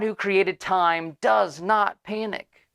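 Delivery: slow, weighty emphasis. Real, not acted. Preacher stresses God's calm to the congregation, slow and weighty. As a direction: lay each word down slowly. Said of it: "preacher emphasis".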